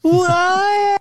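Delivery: very high, far squeal; squealing cry. Retold or imitated. Squealing panda cry while jumping out. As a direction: imitate a tiny animal's cry. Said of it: "you hear".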